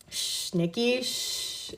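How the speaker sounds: soft, drawn-out shushing, fading to breath; soothing hush. A narrator's reading. Andrew shushing a frightened Nikki, mock-soothing. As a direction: hush someone like a troubled child. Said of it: "like he was soothing a troubled child".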